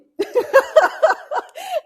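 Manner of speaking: high, loud burst of joyful laughter. Real, not acted. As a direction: laugh out with pure delight. Overjoyed at veteran's donation.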